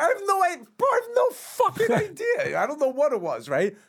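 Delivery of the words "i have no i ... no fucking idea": high, cracking laugh-talk; words breaking into real laughter; laughing helplessly. Real, not acted. Laughing while recalling absurd story.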